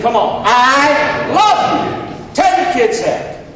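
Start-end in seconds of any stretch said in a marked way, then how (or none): 0.4-2.2 high, loud, drawn-out call; stretched, forceful lead for the crowd; emphatic prompt. Shown as a demonstration. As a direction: belt it out slowly to rally the room. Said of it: "come on, that's weak".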